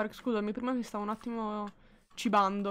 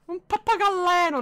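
excited; 9 semitones higher, 9 dB louder than usual. Real, not acted. Spots a parrot suddenly.

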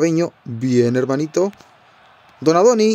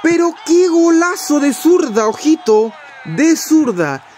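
breakneck goal commentary; 12 semitones higher, slower than usual. Real, not acted. His player scores a stunning goal.

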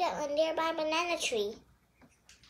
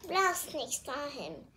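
plain reading voice

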